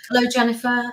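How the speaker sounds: ordinary delivery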